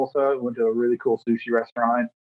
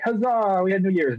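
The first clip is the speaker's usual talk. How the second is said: higher, hammed-up triumphant cheer; mock cheer; 7 semitones higher than usual. Celebrating New Year's, a higher hammed-up cheer. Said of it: "I was like".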